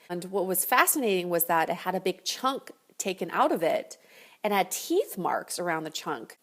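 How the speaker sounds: ordinary talk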